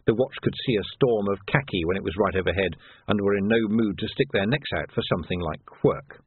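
ordinary delivery, nothing marked

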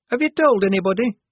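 high-voiced, anxious recruit; embarrassed Shufti. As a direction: ask anxiously, pitched high and loud. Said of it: "mumbled Shufti".

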